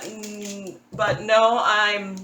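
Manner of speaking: ordinary talk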